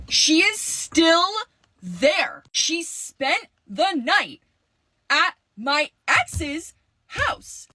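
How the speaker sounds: drawn-out shocked outrage; stunned disbelief. Real, not acted. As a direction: spell it out, slowly, like you can't believe it. Outraged at friend's sleepover.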